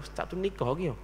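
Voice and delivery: gossipy aside. Retold or imitated. People at his teaching places gossiping about him, hushed. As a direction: murmur it like people gossiping behind his back. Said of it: "banyak orang tempat mengajar tanya dah".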